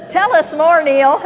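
high, loud call breaking into laughter; playful shout. Pretend. Joking with the congregation about hidden sins, laughing shout. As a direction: laugh and holler it out.

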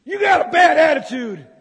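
loud, high, rebuking shout; preaching shout. Real, not acted. Preacher shouting a rebuke at the congregation. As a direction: call them out, full force.

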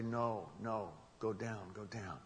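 low, hushed retold warning; quiet voice of guidance, fading; inner warning voice. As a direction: say it softly and low, sinking further away. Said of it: "I hear this voice say".

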